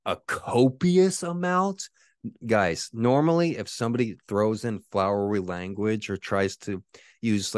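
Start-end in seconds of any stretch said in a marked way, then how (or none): 0.1-1.9 high, slow, disbelieving; incredulous echo. Retold or imitated. Streamer mocking the troll's fancy word, slow incredulous. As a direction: repeat his word back in disbelief. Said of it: "And then I'm like".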